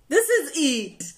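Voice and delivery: high, loud, drawn-out cry of delight; thrilled exclamation. Retold or imitated. Singer relives falling for opera, high thrilled cry. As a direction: exclaim it slowly, swept away by the discovery.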